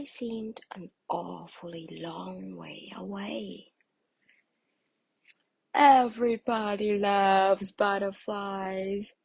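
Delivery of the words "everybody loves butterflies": slow, louder spider voice; a creepy-crawly storybook spider; spindly spider. A narrator's reading. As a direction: spin the words out slowly. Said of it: "said a spindly spider".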